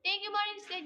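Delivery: bright, loud shout-out of thanks; loud thank-you. Real, not acted. Streamer loudly thanking a gifter, loud shout. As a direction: call the thanks out high and loud.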